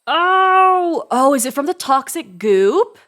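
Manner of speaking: loud, high burst of realization; sudden realization. Real, not acted. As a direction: it just clicked, blurt it out. Sudden realization during play.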